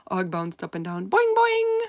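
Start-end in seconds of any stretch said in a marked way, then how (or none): 1.1-1.8 very high, loud springy boing boing; high, loud bouncy twang; frog boing. A narrator's reading. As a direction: bounce the sound like a cartoon frog.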